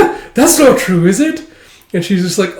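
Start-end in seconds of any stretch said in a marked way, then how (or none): 0.0-1.4 put-on flustered girl. Flustered Yor voiced.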